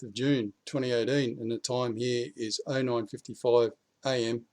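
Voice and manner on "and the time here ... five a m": ordinary talk, nothing marked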